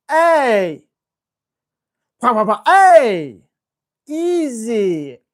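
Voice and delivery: loud firm hey, then easy; sharp correction. Shown as a demonstration. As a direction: bark it out to stop the grab. Demonstrating correction for grabby dog.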